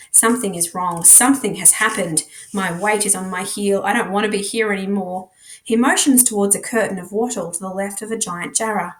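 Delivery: ordinary delivery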